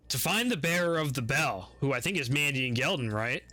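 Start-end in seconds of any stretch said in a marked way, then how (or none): none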